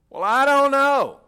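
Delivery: fed-up father blurting out loud; exasperated dad. Acted. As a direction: throw up your hands in loud exasperation.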